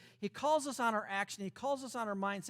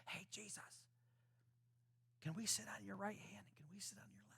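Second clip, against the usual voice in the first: whisper; 18 dB quieter than usual. Acted. Disciples secretly asking for status.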